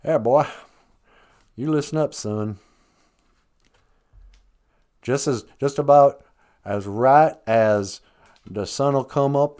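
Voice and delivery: put-on southern accent, folksy speechifying. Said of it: "I could just adopt the southern accent".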